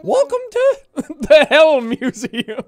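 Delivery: laughing through it. Pretend. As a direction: try to stay in character but crack up. Blathers voice breaking into laughter.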